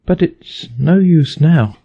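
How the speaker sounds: put-on high, slow, rueful girl's voice; forlorn Alice. A narrator's reading. Alice lamenting how small she is, high and slow. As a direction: sigh it out ruefully.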